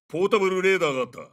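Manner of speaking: usual speaking voice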